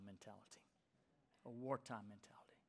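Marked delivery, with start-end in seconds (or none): 1.4-2.6 soft whisper, almost breathless; whispered repeat. Acted. Preacher whispering the phrase back to the congregation. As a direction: let the phrase sink in with a whisper.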